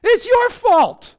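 high, loud accusing shout; blaming outburst. Acted. Preacher voicing a blaming cry, high drawn-out shout. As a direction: hurl the blame, stretched out.

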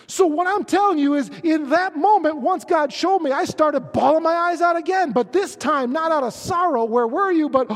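loud, high, impassioned preaching; preaching at full force. Acted. Pastor testifying to his congregation, loud impassioned preaching. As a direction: preach it to the back row, full voice.

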